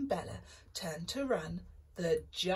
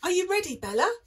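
eager, loud call; excited; 11 semitones higher, 8 dB louder, much faster than usual. Acted. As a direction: call out keen to go. Jack eager to climb.

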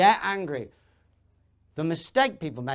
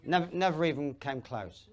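usual speaking voice

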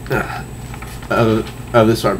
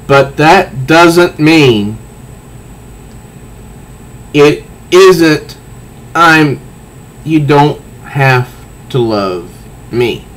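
plead, then let it fade; emotional comic reading; 4 semitones higher, much slower than usual. Acted. Reads Selina's plea.

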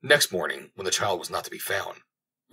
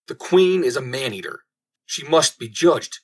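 raised, emphatic outcry, higher in pitch; loud public cry; 5 semitones higher, 5 dB louder than usual. A narrator's reading. Angry people accusing the queen before her counselors, crying loudly. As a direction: cry out an accusation, drawing out the verdict. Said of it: "all the people cried loudly".

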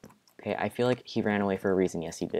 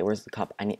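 usual speaking voice

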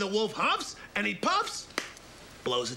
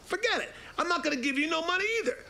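high, fast pig voice talking back; defiant pig; 11 semitones higher, faster than usual. Acted. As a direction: snap it off quickly, high and cocky. Pig refuses wolf.

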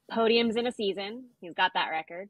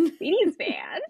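mock echo; 10 semitones higher, 5 dB louder, slower than usual. Echoing the mocking line.

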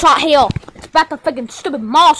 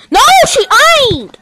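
indignant protest; 8 semitones higher, faster than usual. Acted. Contradicting in an acted squabble.

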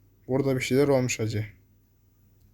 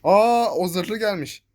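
surprised; 6 semitones higher, 4 dB louder, much slower than usual. Real, not acted. Armored enemy appears.

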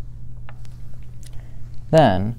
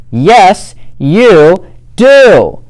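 loud, much higher shout; 9 semitones higher, 11 dB louder, much slower than usual. Acted. Fluffy Bear shouting down Smelly Bear, loud and high. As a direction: top the other bear's yell. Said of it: "shouted Big Fluffy Bear".

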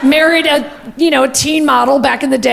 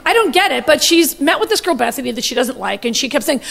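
normal talking voice

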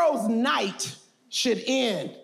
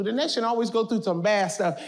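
ordinary talk

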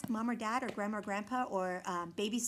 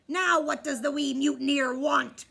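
louder, gruff demand; demanding pirate; 3 semitones higher, 8 dB louder than usual. A narrator's reading. Pirate captain annoyed at the fussy baby. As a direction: bark the question at the baby. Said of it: "Braidbeard demanded".

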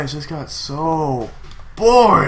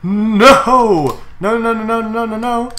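higher, rapid string of noes; dismayed no; 8 semitones higher, 4 dB louder, slower than usual. Real, not acted. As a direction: react in alarm at the screen. Dismay at a battle turn.

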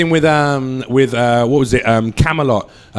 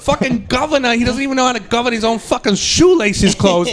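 higher, ranting Boston comic voice; exasperated Boston accent rant, pitched up; Bill Burr Boston impression; 7 semitones higher than usual. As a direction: riff like an incredulous Boston stand-up. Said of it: "That was my Bill Burr".

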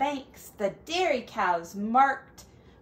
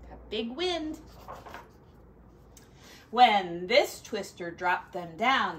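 ordinary delivery, nothing marked

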